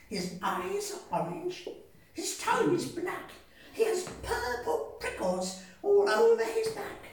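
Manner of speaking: high mouse voice, steady and teasing; mouse describing. Acted. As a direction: list it off with relish.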